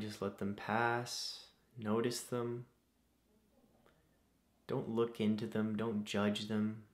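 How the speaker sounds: soft, unhurried meditation voice with long pauses; guided meditation. Acted. Host leading listeners through breathing to calm anxieties, guided meditation. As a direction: guide them gently through each breath, leaving space.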